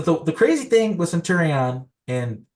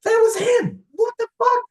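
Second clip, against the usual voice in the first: shocked disbelief. Retold or imitated. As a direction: yelp it out, stunned. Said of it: "we're like".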